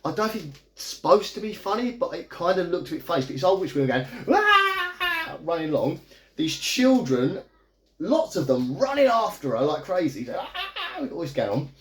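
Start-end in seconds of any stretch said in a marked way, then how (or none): 4.3-5.4 high, drawn-out mock shriek; imitated witch scream. Old witch running from the children, imitated high scream. 10.4-11.0 high, softened mock shriek; imitated crazed scream. Children running after the witch like crazy, held-back high scream.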